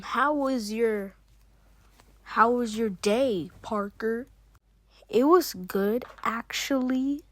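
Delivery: ordinary talk, nothing marked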